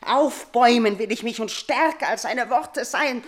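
normal talking voice

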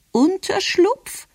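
very high, stretched-out query; baffled grandmother. A narrator's reading. Grandmother repeats a puzzling word from the petition, baffled and high. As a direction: echo the word slowly, pitched right up.

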